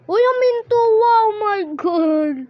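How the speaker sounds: real excited exclamation; amazed outburst. Real, not acted. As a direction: cry out in amazement, stretching the words. Amazed by game event.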